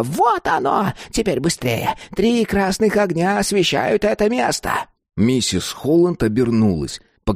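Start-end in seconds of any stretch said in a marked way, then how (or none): 0.0-4.7 high, urgent crone cry; excited old woman. A narrator's reading. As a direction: urge him on in an excited high voice. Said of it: "закричала старуха".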